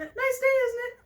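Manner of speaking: very high, stammering character voice; nervous forced greeting. Acted. Pelly making small talk to Pete, nervously squeaked. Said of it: "force myself up to croak out".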